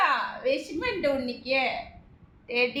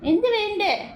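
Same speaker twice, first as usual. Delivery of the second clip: 1 higher coaxing question; 7 semitones higher than usual. Real, not acted. Repeats question higher to pet.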